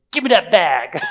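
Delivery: loud, high comic outburst; hammed-up yell, played for laughs; mock desperate demand. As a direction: shout it as if giving in to craving.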